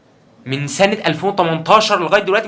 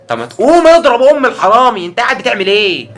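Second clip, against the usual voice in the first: high, loud exasperated yell; scolding shout; 8 semitones higher, 8 dB louder than usual. Acted. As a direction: shout at him to get up and act. Scolding someone just praying. (صراخ توبيخ عالي)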